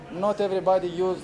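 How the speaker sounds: ordinary talk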